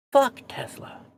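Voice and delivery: whispered mock curse. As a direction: hiss it under your breath. Second take of the same quote.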